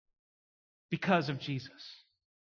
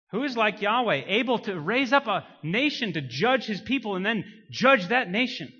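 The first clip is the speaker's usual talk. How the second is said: loud, higher sermon cry with swooping emphasis; full-force preaching; 6 semitones higher, 7 dB louder than usual. Real, not acted. Preacher exalting God as judge of nations, impassioned preaching. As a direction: proclaim it forcefully to the congregation.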